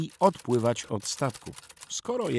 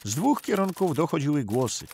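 plain reading voice

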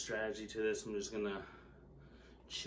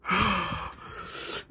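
pained exhale; 9 semitones higher, 6 dB louder than usual. Real, not acted. Pained breathing.

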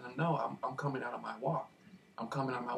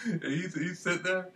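laughing; 5 semitones higher, slower than usual. Real, not acted. Still laughing while telling it.

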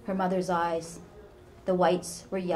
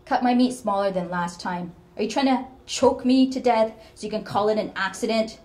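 louder and higher, venomous; a paranoid old mother snarling; spiteful accusation; 4 semitones higher, 10 dB louder than usual. Acted. Mother accuses daughter at supper.

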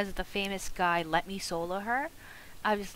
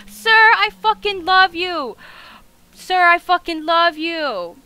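ecstatic adoration; 6 semitones higher, 10 dB louder than usual. Real, not acted. Gamer worshipping the helper who won it, ecstatic. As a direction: proclaim devotion at the top of your voice.

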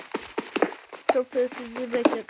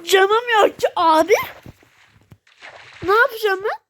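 whining; 8 semitones higher, 9 dB louder than usual. Real, not acted. Whining about no house. (evi olmadığı için sızlanarak, yüksek sesle)

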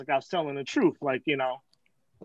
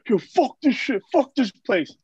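higher-pitched mimic of an angry friend; retold pissed-off outburst; 5 semitones higher than usual. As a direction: act out his fed-up cursing. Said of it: "He gets pissed off".

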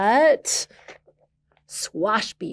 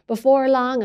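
ordinary delivery

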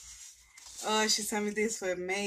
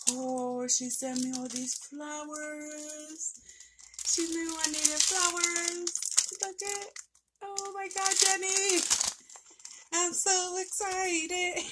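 excited squeal; 8 semitones higher than usual. Real, not acted. Opening gift flowers from friend.

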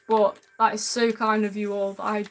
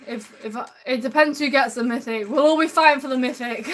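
normal talking voice

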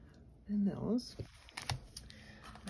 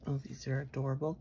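normal talking voice

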